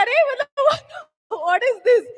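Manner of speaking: high, laughing, baffled outburst; exasperated disbelief. Retold or imitated. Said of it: "And I'm like".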